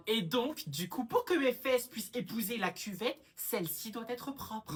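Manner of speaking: loud, high, insistent lecture; exasperated explaining. Acted. Sister spells out toilet rules to brother, exasperated.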